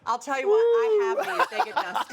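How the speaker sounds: high, loud put-on friend voice; lightly voiced house guest; mock friend voice. Imitating a friend showing off blinds.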